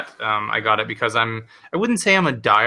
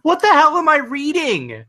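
very high, slow squeal of disbelief; incredulous outburst; 4 dB louder than usual. Real, not acted. Host reacting to absurd mustache article, incredulous high cry. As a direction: stretch it out in high-pitched bafflement.

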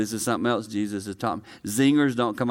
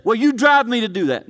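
higher and louder, whiny excuse; mock blaming spouse; 11 semitones higher, 7 dB louder than usual. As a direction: throw the blame back like a sulky spouse. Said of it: "you say".

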